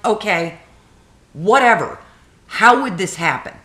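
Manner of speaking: exasperated retelling. Retold or imitated. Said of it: "I'm like".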